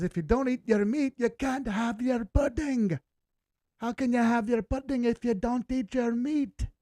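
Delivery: a hammed-up Scottish teacher scolding the class; attempted Scottish accent. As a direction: bark it like the Scottish teacher from The Wall. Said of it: "I can't speak in that good of an accent".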